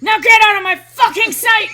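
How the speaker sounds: very high, very loud yell breaking into laughter; furious shout, laughing. Retold or imitated. Reenacting the rant to a friend, a laughing furious shout. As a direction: scream it through a laugh. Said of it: "so quiet but still angry".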